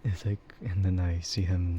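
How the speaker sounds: ordinary talk, nothing marked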